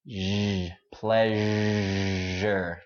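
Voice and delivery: "pleasure" with the voiced zh buzzing; voiced sound. Shown as a demonstration. Teacher contrasting the voiced sound with the voiceless one. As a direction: voice the zh in "pleasure" clearly. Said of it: "But this one có giọng".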